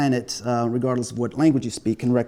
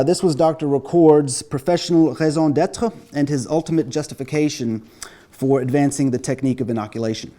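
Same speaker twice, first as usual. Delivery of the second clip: normal talking voice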